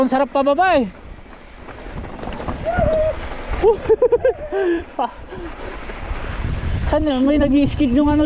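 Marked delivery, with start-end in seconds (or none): 1.7-6.8 long, high giddy laughter. Real, not acted. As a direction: laugh freely on the ride. Laughing while riding down.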